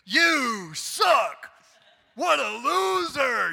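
mock yelling parent. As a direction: bellow insults like a cartoon bully. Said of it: "we don't do that".

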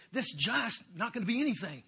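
put-on cocky young David. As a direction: brag like a kid sure of his faith. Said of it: "He said".

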